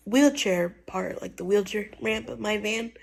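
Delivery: ordinary talk, nothing marked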